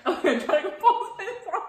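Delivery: high, loud, fighting down giggles; stifled laughing. Real, not acted. Friend trying to recover on camera, laughing helplessly. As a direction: try to talk through a laughing fit.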